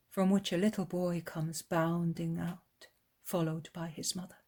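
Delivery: ordinary delivery, nothing marked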